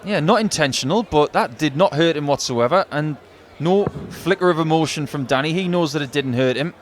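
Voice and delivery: ordinary delivery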